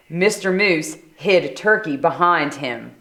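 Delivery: ordinary delivery, nothing marked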